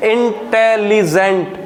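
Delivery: Intelligent slowly split as इन, टे, ली, gent; syllable by syllable. Shown as a demonstration. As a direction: pause between each syllable of intelligent. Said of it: "ज़्यादा बार मुझे रुकना पड़".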